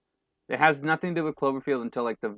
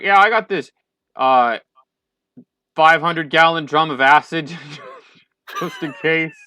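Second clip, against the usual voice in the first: loud, higher jokey character voice; John Goodman character; 5 semitones higher, 9 dB louder than usual. Retold or imitated. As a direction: boast it, loud and proud. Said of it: "John Goodman's character's like".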